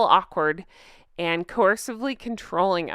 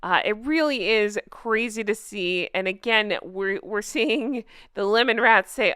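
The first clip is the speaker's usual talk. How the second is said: ordinary talk, nothing marked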